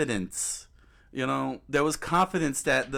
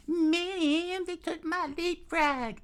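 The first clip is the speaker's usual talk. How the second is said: much higher, slow, laughing mock child; whiny kid voice; much slower than usual. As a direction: whine like a kid whose toy got taken. Mocking a kid losing his toy.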